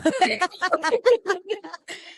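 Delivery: bursts into a high laugh. Real, not acted. Laughs at own joke.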